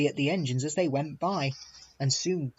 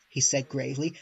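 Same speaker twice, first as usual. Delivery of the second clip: ordinary delivery, nothing marked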